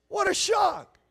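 high, loud mock surprise; sarcastic shock. Real, not acted. Preacher mocking the judges' vote, sarcastic shout. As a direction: throw it out with heavy sarcasm.

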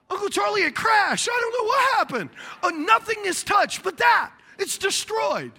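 put-on a kid caught red-handed, protesting too much; kid's panicked excuse. Child confesses broken model.

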